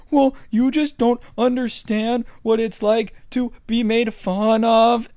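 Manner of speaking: high, slow whiny voice; crying kid. Retold or imitated. Crying kid defending himself to the coach, high whiny voice. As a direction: whine like a kid near tears. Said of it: "And the kid's like".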